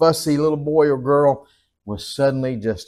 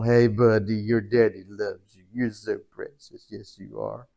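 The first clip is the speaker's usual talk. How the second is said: put-on soft, low, tender baby talk that fades away; cooing to baby; 6 semitones lower, 5 dB quieter than usual. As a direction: murmur lovingly to a newborn. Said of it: "I talked to him for a little while".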